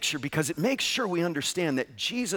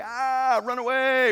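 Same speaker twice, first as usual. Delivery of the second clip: high, startled cry to flee; mock alarm; 9 semitones higher, slower than usual. Churchgoer recoiling from the preacher in mock alarm. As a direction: yelp it like you want out of there. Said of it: "You'd say".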